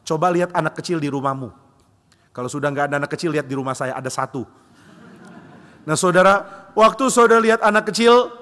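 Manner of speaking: ordinary talk, nothing marked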